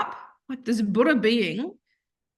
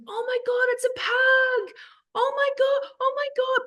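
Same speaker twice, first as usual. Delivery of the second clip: much higher, squealing over a cute pug; gushing stranger. Retold or imitated. Stranger spotting the pug from across the road, squealing high. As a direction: coo and squeal like a fan spotting a puppy.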